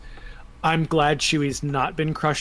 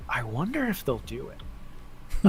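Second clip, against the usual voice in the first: soft, slow musing to oneself; tentative wondering; 7 dB quieter, slower than usual. Retold or imitated. Fan recalls guessing at a film's plans, softly wondering. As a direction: wonder it aloud, quietly and slowly. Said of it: "I was like".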